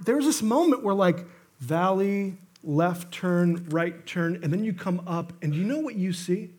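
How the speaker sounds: usual speaking voice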